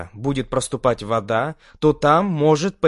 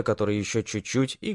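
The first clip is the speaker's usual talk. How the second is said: ordinary delivery, nothing marked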